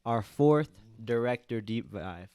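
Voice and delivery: loud, upbeat show opening; podcast host intro. Acted. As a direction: hype up the episode like a host. Opens the podcast episode.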